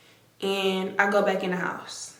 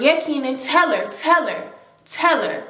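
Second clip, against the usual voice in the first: Keisha insisting; 5 semitones higher than usual. Retold or imitated. Keisha voiced in the background.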